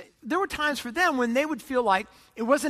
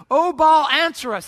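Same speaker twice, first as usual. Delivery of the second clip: loud, raised call to a god; shouted plea; 4 semitones higher, 7 dB louder than usual. Acted. Priests of Baal calling on their god, shouting. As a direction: cry out to Baal for an answer. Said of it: "They shouted. They're shouting".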